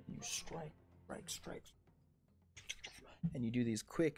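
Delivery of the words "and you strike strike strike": repeated strike words sinking to a whisper; fading whisper. A narrator's reading. Game master narrating rapid blows, whispering away. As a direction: let each strike fade into breath.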